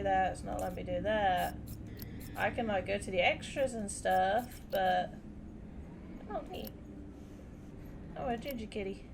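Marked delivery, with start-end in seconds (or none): none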